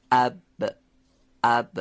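اب closed firmly on a voiced, light b; stopped, voiced b. Shown as a demonstration. Teacher demonstrating the letter ba, stopped voiced b. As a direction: shut the lips on اب, then release the b. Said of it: "به صورت انسدادی و نازک هم تلفظ بشه".

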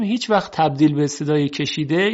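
usual speaking voice

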